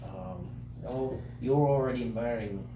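soft, slow, distracted mumble; muttering to himself. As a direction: trail off as if thinking aloud. NPC mumbles searching for recipient.

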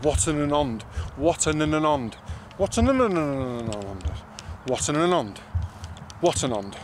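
careful rehearsal of Watananond; practising the name. Shown as a demonstration. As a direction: drill Watananond over and over. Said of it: "that's not how you say it".

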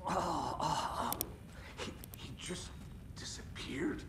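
high, breath-starved shock, words catching; stunned disbelief. Acted. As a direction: gasp it out haltingly, voice fading into a whisper. Stunned after someone vanished.

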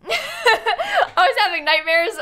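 laughing through the words, slow; real laugh while talking. Real, not acted. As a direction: giggle it out. Laughing at own tiredness.

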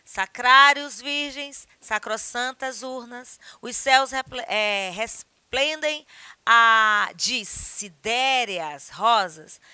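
slow, grand poem reading; poem recital. Acted. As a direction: declaim the verses slowly. Reads a poem aloud. (declamação de poema)

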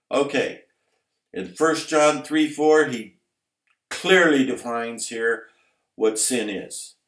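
loud, high fiery sermon, slowing on the key words; preaching at full force. Acted. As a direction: thunder it from the pulpit.

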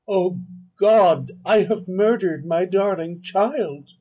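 high, loud, slow cry of horror and grief; anguished exclamation. A narrator's reading. Elizabeth over the boy's corpse, anguished high loud cry. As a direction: cry it out as if seeing the dead child.

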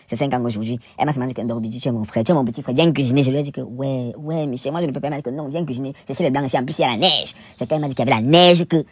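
ordinary talk, nothing marked

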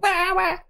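very high imitated baby cry; mock baby wail. Orc baby in the background, imitated high-pitched wailing. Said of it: "the orc's baby's like".

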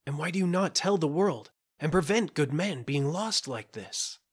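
crying out. A narrator's reading. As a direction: challenge her with a heated cry. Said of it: "cried Gervais".

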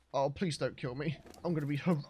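scared. Real, not acted. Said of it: "freaking me out".

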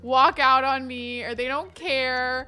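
usual speaking voice

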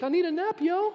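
high, laid-back mock voice of Jesus; a breezy high-pitched yawner; casual Jesus. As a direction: toss it off like a sleepy surfer. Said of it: "He's like".

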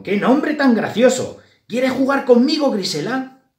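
high, loud, eager mouse voice; friendly mouse. A narrator's reading. The other mouse asks Grisela to play, eager.

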